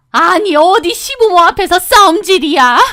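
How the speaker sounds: high, loud mother-in-law rebuke; scolding. Acted. Mother-in-law scolding couple for fighting, indignant. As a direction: scold them like children.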